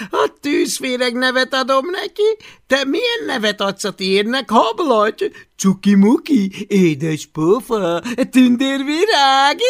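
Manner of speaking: high, loud sneering sing-song taunt; mocking bully Takonypóc. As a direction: belittle the little dragon. Takonypóc csúfolódik. (magas, csúfolódó gúnyhang)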